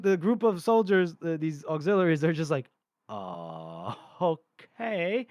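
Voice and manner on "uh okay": long low "uh", then rising okay; baffled hesitation. Acted. Confused soldiers facing screaming maniacs, baffled hesitation. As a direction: stall on a low drone, then a questioning okay.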